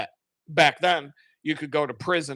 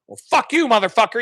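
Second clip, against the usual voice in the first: much higher, louder mock yell of rage; furious master; 7 semitones higher, 7 dB louder, faster than usual. Master damning the unforgiving servant, retold mock yell. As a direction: blow up at him in a burst of mock fury.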